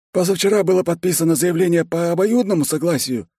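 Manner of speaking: high-pitched, precise character voice; secretary clarifying. A narrator's reading. Secretary clarifying the divorce filing, high and precise. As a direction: pipe it up high and matter-of-fact. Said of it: "уточнил секретарь".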